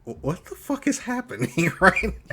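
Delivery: high, incredulous and half laughing; laughing mock bewilderment; bewildered. Acts out own bewildered reaction.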